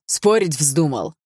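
father daring his son to argue; menacing challenge. Acted. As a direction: lean in and dare him.

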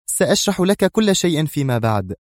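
firm. A narrator's reading. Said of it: "أجابته في حزم".

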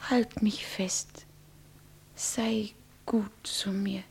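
slow, soft, intimate whisper; whispered plea. Acted. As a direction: breathe it close to his ear. (langsam, zärtlich geflüstert)

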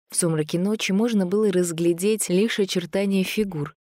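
plain reading voice

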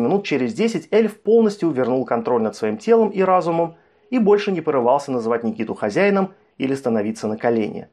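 plain reading voice

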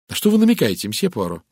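sharp, suspicious question; rather sharp. A narrator's reading. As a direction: demand an explanation, fairly sharply. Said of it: "довольно резко спросила Кристина".

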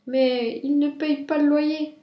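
put-on childlike high-pitched complaint; protesting rabbit. A narrator's reading. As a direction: object in a high, drawn-out whine. Rabbit objects to mother on phone.

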